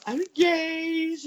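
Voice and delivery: high, slow, gushing squeal; hammed-up thrilled bride; mock giddy announcement. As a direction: play the breathless new fiancée. Mock excited engagement announcement.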